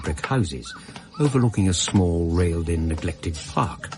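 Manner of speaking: measured, reflective storytelling voice; story narration. A narrator's reading. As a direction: recount it like a memoir read aloud.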